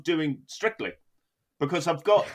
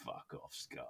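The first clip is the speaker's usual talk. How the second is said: very low, very quiet grumble; low growled aside at the sky; mock dismissive; 13 semitones lower, 24 dB quieter than usual. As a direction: mutter it down at the sky, deep and dismissive. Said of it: "doesn't look up at the sky and go".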